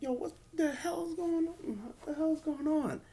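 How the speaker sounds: high, panicky imitation of a confused wrestler; mock freaking out. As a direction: yelp it in hammed-up panic, slowing at the end. Priest confused amid zombies.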